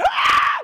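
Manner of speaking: a sudden piercing high shriek of fright. Retold or imitated. Retelling the spider scare, a sudden high frightened shriek. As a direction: shriek like a spider just darted past. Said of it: "I shrieked".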